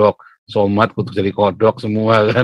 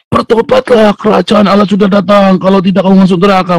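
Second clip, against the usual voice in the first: much higher, loud bus-terminal hellfire cry; street preacher shout; 10 semitones higher, 8 dB louder than usual. Retold or imitated. Teen evangelist yelling repentance at the bus terminal, loud and high. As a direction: bellow the warning at the whole terminal. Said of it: "Saya terminal berdiri".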